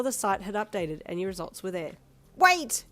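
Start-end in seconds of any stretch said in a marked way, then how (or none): 2.4-2.8 sudden high loud screech. Acted. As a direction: cut him off with a shriek. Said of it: "you screeched".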